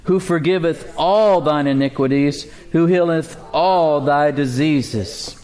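slow, deliberate scripture reading, leaning on "all". Shown as a demonstration. Preacher reads the verse to the congregation, slowly. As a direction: read slowly, drawing out each "all". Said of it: "Read it slowly".

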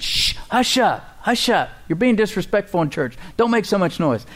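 a crowd hissing at a noisy beggar; shushing crowd. Acted. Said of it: "the more the people said".

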